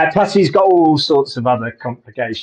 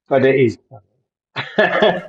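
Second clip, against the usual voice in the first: stifled laugh; slower than usual. Real, not acted. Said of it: "I shouldn't laugh".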